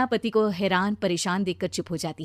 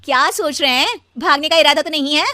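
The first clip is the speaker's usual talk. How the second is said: loud, higher-pitched wifely teasing challenge; sharp challenge; 6 semitones higher, 10 dB louder than usual. Acted. Wife asking if he plans to run away, loudly challenging. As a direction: pounce on him with the question.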